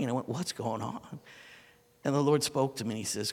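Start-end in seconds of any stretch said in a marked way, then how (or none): 0.3-1.2 choked, much softer and lower; tearful question. Retold or imitated. Overwhelmed preacher on the floor, asking through tears. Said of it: "I couldn't stop crying".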